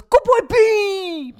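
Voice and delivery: high, loud, drawn-out falsetto praise; dog dad imitation. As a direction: mimic a doting dog dad whooping. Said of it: "he doesn't even say Benny".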